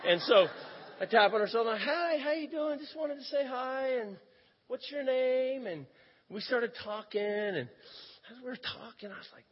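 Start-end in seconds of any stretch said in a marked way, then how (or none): 1.8-5.7 high, drawn-out friendly hello; high-voiced, slow "nice to meet you" opener; friendly greeting. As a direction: act out a chirpy introduction, pitched up. Chirpy greeting retold to woman.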